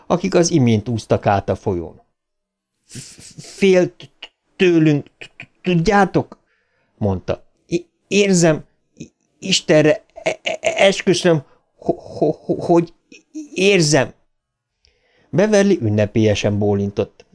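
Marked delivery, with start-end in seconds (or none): 3.4-6.4 high, halting stammer; slow, stammered confiding; stuttering. Acted. Stuttering character speaks. 7.7-14.1 high, broken stammer; halting, stammered oath; stuttering. Acted. Stuttering character swears he feels it.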